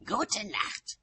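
high, falling "Gute Nacht"; high-pitched parting retort; curt farewell. A narrator's reading. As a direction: end the quarrel with a high goodnight. Frosty parting after quarrel.

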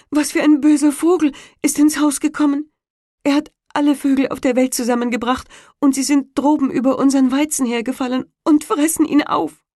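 high, fast, agitated wife's voice; upset wife. A narrator's reading. Wife telling husband the birds eat the wheat, high and fast. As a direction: rush out the bad news.

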